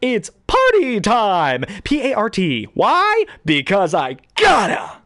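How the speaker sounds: hammed-up Jim Carrey hyping a party; Jim Carrey impression. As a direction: manic comic whoop, slow and drawn out. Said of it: "I saw Jim Carrey".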